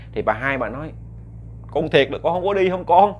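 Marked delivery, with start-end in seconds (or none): 1.7-3.1 mother pressing. Acted. Mother presses son for truth.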